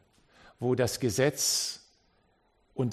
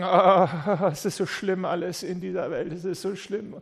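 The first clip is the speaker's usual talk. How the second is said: higher, laughing whiny complaint; mock lament; 4 semitones higher than usual. Preacher mocking a fearful church that wants to shut itself off, mock whining lament. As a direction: parody a gloomy church hiding from the world. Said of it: "wo wir sagen".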